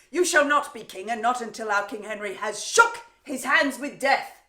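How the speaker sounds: ringing public condemnation; loud denunciation. Acted. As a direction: declare it hard, in mock outrage. Denounces captive's claim.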